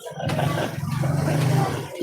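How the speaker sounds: breathy laugh. Real, not acted. Laughing during livestream chat.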